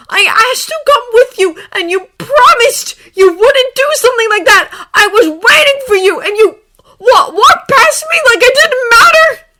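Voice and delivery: high, very loud, tearful tirade; anguished yelling. A narrator's reading. Draco pouring out betrayal at Harry, tearful yelling. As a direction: shout it all out, breaking into tears. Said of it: "Draco yelled".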